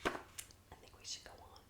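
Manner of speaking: breathy whispered line; hushed whisper. Acted. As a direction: whisper it so no one hears. Whispered dialogue in story.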